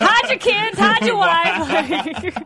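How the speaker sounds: laughing, loud; laughing meme quote. Pretend. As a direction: say it while cracking up. Quoting a meme as a joke.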